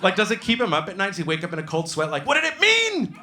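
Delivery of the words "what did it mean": high, loud anguished cry; nightmare outburst. Acted. Cop wakes in a cold sweat, anguished yell. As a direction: wake up yelling in mock torment.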